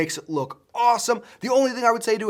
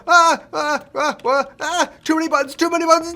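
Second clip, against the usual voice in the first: high flustered yelps; panicked; 8 semitones higher, 5 dB louder than usual. Real, not acted. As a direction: panic over the controls. Panicking at game controls.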